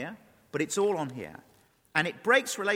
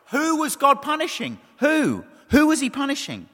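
louder, higher, insistent question; emphatic challenge; 8 semitones higher, 11 dB louder than usual. Real, not acted. Preacher challenging the congregation with the key question, loud and emphatic. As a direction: hammer the question out loudly.

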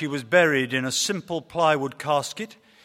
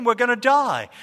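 higher, louder, building panic; shouting in rising terror, louder each line; panicked yell; 7 semitones higher, 6 dB louder than usual. Acted. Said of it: "he panicked".

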